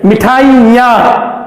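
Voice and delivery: मिठाइयां drawn out slowly, final आ through the nose; Hindi: मिठाइयां धीरे, आ को नाक से; slow nasal demo. Shown as a demonstration. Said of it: "नाक के सहयोग से बोलना है".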